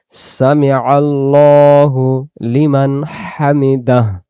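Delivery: Arabic সামি আল্লাহু লিমান হামিদাহ drawn out slowly, দাহ ending clear; slow recited Arabic. Shown as a demonstration. Teacher demonstrating prayer recitation, slow and drawn out. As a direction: chant the prayer phrase slowly with full endings. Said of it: "দাঁড়ানোর সময়".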